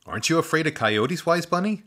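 put-on breathless thanks from a boy; boy voice, grateful. Boy asks bunny.

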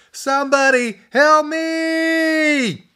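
drawn-out terrified scream. A narrator's reading. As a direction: drag out the panicked cry. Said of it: "Drew screamed".

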